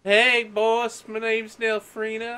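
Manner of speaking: loud, very high Southern character; Southern accent. Acted. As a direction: belt it out as a high-voiced Southerner. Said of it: "please have a southern accent".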